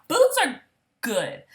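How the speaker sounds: sudden high squeal; excited. Real, not acted. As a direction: burst out with delight.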